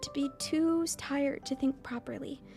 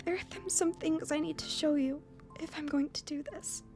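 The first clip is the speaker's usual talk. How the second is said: higher, halting, softening request; composing after sobs; 8 semitones higher than usual. Acted. Emmy inviting Hisao home, more composed after crying. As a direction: ask tentatively, settling down from tears. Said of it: "a little more composed".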